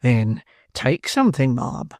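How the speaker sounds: slow, soothing, trailing off on ma'am; soothing beadle. Acted. Beadle soothing the distressed matron, slow and gentle. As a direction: coax her gently. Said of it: "said Mister Bumble soothingly".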